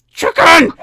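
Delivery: scolding a chicken; shouting at a chicken. Real, not acted. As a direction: call out sharply to the chicken.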